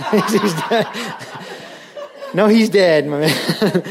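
laughing loudly through the words; laughing out loud at the punchline. Real, not acted. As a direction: crack up at the punchline. Laughing at his own joke.

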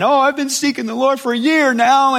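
high, loud hammed-up wail; acted-out tearful convert; mock crying. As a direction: wail it tearfully, then break into mock sobs. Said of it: "They're crying".

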